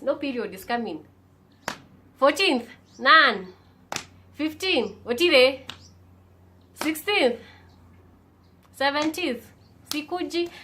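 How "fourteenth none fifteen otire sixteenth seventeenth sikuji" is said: rising alarm. Real, not acted. Counting days of missed period.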